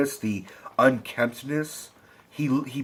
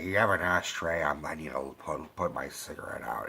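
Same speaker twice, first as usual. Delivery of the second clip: low, halting mumble, dropping softer; 7 semitones lower, 9 dB quieter than usual. Acted. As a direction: impersonate a spaced-out smoker hunting for an ashtray. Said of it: "[name] is like".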